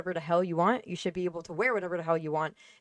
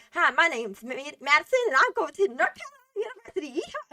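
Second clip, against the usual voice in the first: mock Southern girl; 11 semitones higher, 3 dB louder than usual. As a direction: ham up a Southern girl introducing herself. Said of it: "They'd be like".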